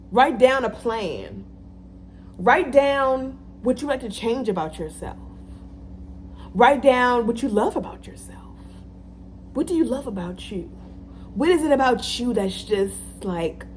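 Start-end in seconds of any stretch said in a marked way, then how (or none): 6.5-10.7 whisper. Real, not acted. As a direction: breathe it out gently. Whispered advice.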